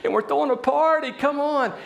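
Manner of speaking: high-pitched, thrilled news-bearer; acted giddy invitation to a party; excited announcement. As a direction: burst out with joyful news, pitch soaring. Acts someone announcing the brother's return.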